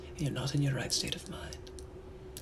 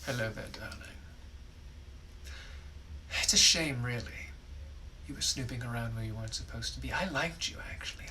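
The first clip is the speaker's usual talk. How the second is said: British gentleman; 3 semitones lower, 5 dB quieter than usual. Acted. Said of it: "I'm also a British gentleman from the eighteen hundreds".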